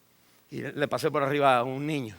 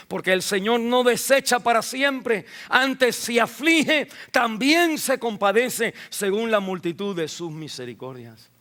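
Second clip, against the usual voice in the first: higher, louder scripture and exhortation; fervent preaching; 8 semitones higher, 6 dB louder than usual. Acted. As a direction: proclaim it with fervor. (predicación ferviente)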